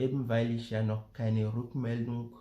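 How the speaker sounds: ordinary delivery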